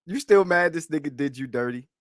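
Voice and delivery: ordinary talk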